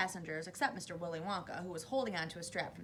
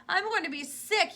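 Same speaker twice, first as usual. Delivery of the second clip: high, loud queasy yell; 10 semitones higher, 9 dB louder than usual. A narrator's reading. Missus T V feeling ill in the lift, loud yell. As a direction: yell it in distress. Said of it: "yelled Missus T V".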